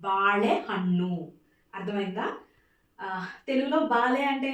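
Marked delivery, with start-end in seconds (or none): 0.1-1.1 బాళే హన్ను slowly, with ళ curled back; slow, careful model of బాళే హన్ను; retroflex ళ. Shown as a demonstration. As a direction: draw out బాళే, tongue back on the l. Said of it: "Pronunciation is very important".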